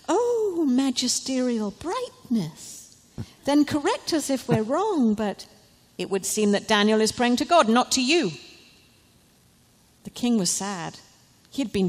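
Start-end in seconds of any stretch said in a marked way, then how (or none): 0.1-8.3 fawning courtiers. Acted. Bad men flatter the king.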